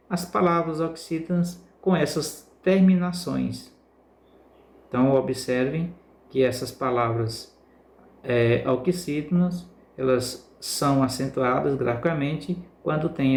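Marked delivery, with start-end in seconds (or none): none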